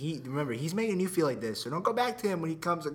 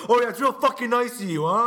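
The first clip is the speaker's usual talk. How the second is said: loud, raised, imitated sarcastic jab; mock bullying sarcasm; 7 semitones higher, 6 dB louder than usual. Friend mimicking his lunch-line confrontation, loud mock sarcasm. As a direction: imitate him picking a fight, loudly.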